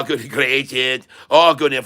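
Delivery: usual speaking voice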